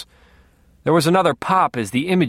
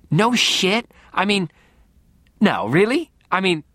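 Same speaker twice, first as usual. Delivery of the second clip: higher, eager blurting; flustered excitement; 6 semitones higher than usual. A narrator's reading. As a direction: fumble the words, starstruck.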